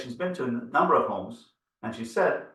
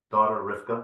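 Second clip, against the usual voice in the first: ordinary talk, nothing marked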